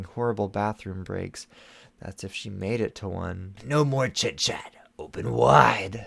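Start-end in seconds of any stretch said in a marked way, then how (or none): 3.6-6.1 slow, whispered witch command. Acted. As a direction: coax her like a wicked witch. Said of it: "said the witch".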